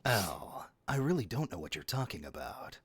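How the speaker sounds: hushed Solid Snake whisper; breathy stealth-soldier whisper. Shown as a demonstration. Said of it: "It's not very hard to talk like this".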